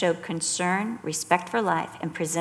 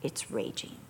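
slow, low, hushed Bible reading; soft reading of the passage to the study group; scripture reading; 4 semitones lower, 7 dB quieter, slower than usual. Acted. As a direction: read it quietly and reverently, dropping away on "its raging". Reads the scripture aloud.

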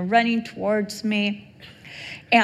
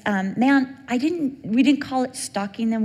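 normal talking voice

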